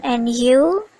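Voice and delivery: you said with a clear upward tune; interested, rising and you; rising intonation. Shown as a demonstration. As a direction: make you climb at the end. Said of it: "Notice the rising intonation on".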